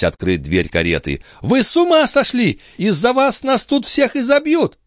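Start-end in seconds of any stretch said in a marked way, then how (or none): 1.4-4.8 high-pitched frantic scolding; crying out. A narrator's reading. Chon fears they will all be beaten, crying out. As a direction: scold in a high alarmed cry. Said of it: "кричала мадемуазель Шон".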